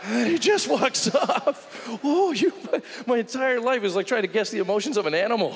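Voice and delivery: very high, strained wail of frustration; exasperated rant. Acted. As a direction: rant it near the top of your range. Exasperated rant about unreadable dad.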